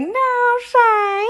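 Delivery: high mock-shy squeak; imitating Bao shy. Imagining Bao refusing to play, a high mock-shy squeak. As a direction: play coy in a little high voice. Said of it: "she was gonna be like".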